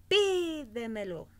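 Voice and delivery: PÍ in Pídemelo stretched high, then falling away; stress on first syllable. Shown as a demonstration. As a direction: hit and hold the PÍ of Pídemelo. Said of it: "en la cuarta o quinta sílaba". (acento alto y alargado en la PÍ de Pídemelo)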